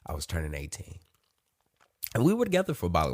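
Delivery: usual speaking voice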